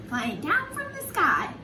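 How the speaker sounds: usual speaking voice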